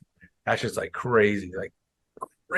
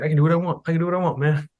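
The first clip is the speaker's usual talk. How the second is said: higher-pitched insistent protest; mock defiance; 6 semitones higher, faster than usual. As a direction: imitate a heckler standing his ground. Heckler defying guard, retold.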